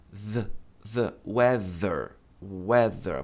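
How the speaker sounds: slow demonstration of voiced TH in "weather". Shown as a demonstration. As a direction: buzz the TH in "weather", tongue between teeth. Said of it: "produz um som de Z e não de S".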